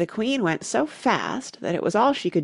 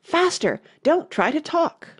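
high-pitched, urgent command; urgent cry; 8 semitones higher than usual. A narrator's reading. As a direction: cry out high to hurry someone along. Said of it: "for she cried".

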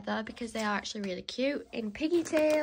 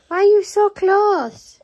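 much higher, louder, slow coo; 9 semitones higher, 15 dB louder, slower than usual. Real, not acted. Owner fussing over the close-up dog, loud high coo. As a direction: squeal at the dog right in your face.

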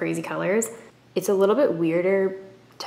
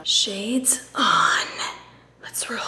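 secret agent whisper; mission whisper; 3 semitones higher, much slower than usual. Pretend.

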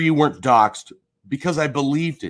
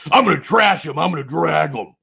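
mocking imitation; 2 semitones higher than usual. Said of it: "you just understand".

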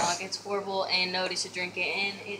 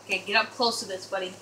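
usual speaking voice

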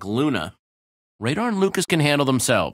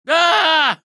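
high loud scream of fright; a sudden sharp shriek of alarm; startled scream; 6 dB louder than usual. Acted.

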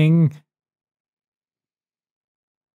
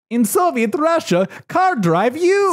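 put-on Russian accent; 12 semitones higher than usual. Russian accent joke after accent talk.

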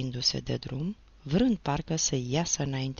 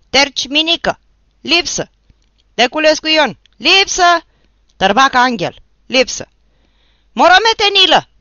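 far higher, very loud roll call; howling with all his might; 11 semitones higher, 15 dB louder than usual. Acted. Toderici howls the roll call with all his might. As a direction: howl every name at full force. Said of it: "urlă din toate puterile Toderici".

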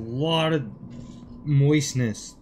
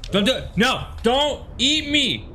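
panicked pleading; 6 semitones higher than usual. Real, not acted. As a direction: beg it not to eat you. Panicked as enemy attacks.

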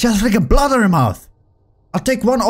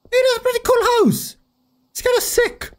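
much higher, amazed; high impressed squeak. Real, not acted. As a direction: squeak out the praise. Impressed by the house.

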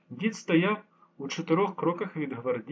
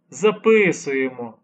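sighing; 8 dB louder, slower than usual. A narrator's reading. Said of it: "зітхнув лейтенант".

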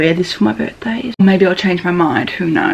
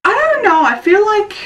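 much higher, torn I don't know; squeaky indecision over the jacket size; high uncertain whine; 12 semitones higher than usual. Real, not acted. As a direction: let the voice jump up in indecision. Unsure about outfit.

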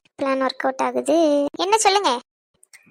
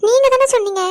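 upset accusation; 8 semitones higher, 6 dB louder than usual. Acted. Tearful accusation of a friend.

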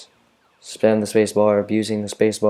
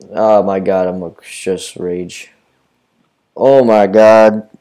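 frustrated rage; 11 dB louder, much slower than usual. Real, not acted. Losing badly, says rage.

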